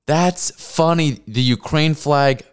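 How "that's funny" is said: very slow, dry; deadpan quote. Retold or imitated. Quoting people online, slow sarcastic deadpan. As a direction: stretch it out sarcastically.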